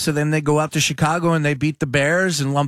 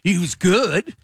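higher mock voice of a scolding host; mocking other hosts; 8 semitones higher than usual. Rival host correcting a great McNabb claim, mocking higher voice. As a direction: lampoon the rival host's correction, pitched up. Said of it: "certain hosts on this station used to say".

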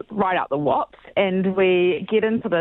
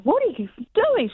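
much higher, alarmed retelling; alarmed shriek; 14 semitones higher than usual. Retold or imitated. Passenger watching her friend drive toward the truck, alarmed and much higher. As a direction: yelp it out like you can't believe what you're seeing. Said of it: "I'm like".

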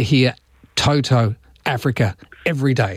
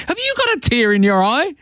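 a higher, lightly voiced wife asking; wife's question; 10 semitones higher than usual. Wife noticing his tears, lightly mimicked higher. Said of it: "and she said".

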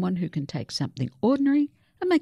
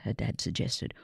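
ordinary delivery, nothing marked

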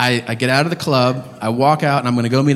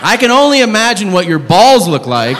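comic rant; 8 semitones higher than usual. Real, not acted. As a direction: yell at him to sort himself out. Ranting at toothless man.

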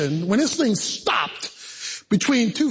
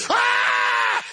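high shouted ah, pitched way up; mock scream; 11 semitones higher than usual. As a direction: let out a quick panicked scream. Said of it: "The screaming".

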